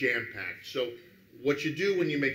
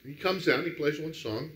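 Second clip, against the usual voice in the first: usual speaking voice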